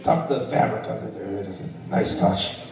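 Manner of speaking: low, grudging villain compliment; grudging overlord praise. Pretend. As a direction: grudgingly admire the sewing.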